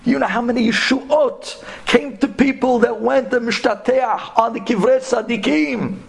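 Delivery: ordinary delivery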